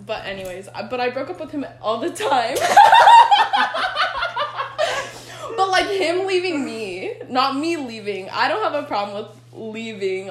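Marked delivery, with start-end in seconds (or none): 2.6-5.5 bursting into loud high laughter. Real, not acted. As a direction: cackle out loud at yourself. Friends laughing at own confession.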